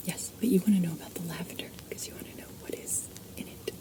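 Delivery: normal talking voice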